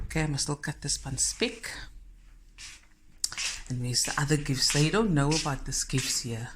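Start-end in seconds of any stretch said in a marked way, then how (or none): none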